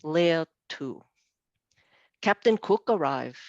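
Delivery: normal talking voice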